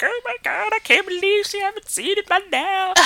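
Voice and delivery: high-pitched gushing mock excitement; excited. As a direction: squeal it like a thrilled fan. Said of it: "you're, like, excited, like".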